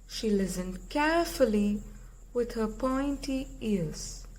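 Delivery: usual speaking voice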